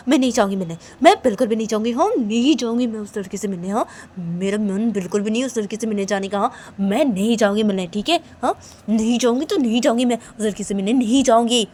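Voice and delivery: emphatic, insistent refusing; stubborn refusal. Acted. Friend refusing to go meet the girl, stubborn and emphatic. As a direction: dig your heels in.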